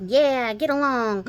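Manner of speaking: slow, laughing Southern drawl; Southern twang mimic. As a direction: slip into Texas twang while laughing. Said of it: "mimic unintentionally".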